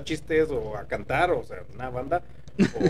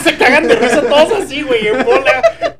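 laughing; 11 semitones higher, 10 dB louder than usual. Real, not acted. Laughing while telling.

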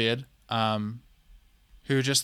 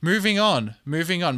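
higher, louder, forcing herself past the rant; exasperated moving on; 5 semitones higher, 5 dB louder than usual. Real, not acted. To her viewers, dropping a topic that infuriates her, exasperated and loud. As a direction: snap out of the rant and push on loudly.

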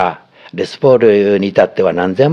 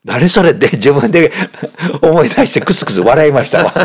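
high-pitched, giggling through the words; tickled by one's own stinginess, laughing; amused at oneself; 6 semitones higher than usual. Real, not acted. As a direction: let real chuckles break into the line. 自分のケチぶりを思い出し笑い.

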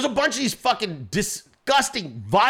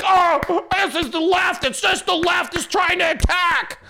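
high, honking clapping-seal imitation; exaggerated alarmed-supporter shout; mock MAGA outcry; 9 semitones higher, slower than usual. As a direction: cry out in a high, mocking voice. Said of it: "like fucking clapping seals".

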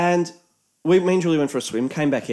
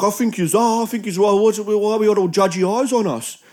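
mock accusing; 5 semitones higher than usual. Young beachgoer accusing the older women of judging, put-on indignation. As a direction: push back as if feeling judged.

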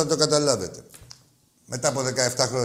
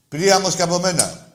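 repeated loud; 5 semitones higher, 6 dB louder than usual. Shown as a demonstration. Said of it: "πες το να το ξαναπεί".

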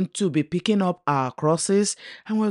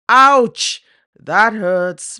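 sharp, much higher and louder "Ouch!"; pained outcry; 8 semitones higher, 9 dB louder, much slower than usual. Acted. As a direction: cry out as if stung by the words. Angelis stung by God's rebuke.

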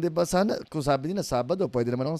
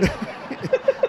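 high unvoiced burst of laughter; real laugh; 3 dB louder than usual. Real, not acted. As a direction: crack up at the tease. Laughs at teasing.

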